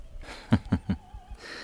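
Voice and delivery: quiet, breathy laugh; soft chuckle. Real, not acted. Guest asked what listeners are in for, soft chuckle. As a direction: chuckle softly before answering. Amused by the interviewer's question.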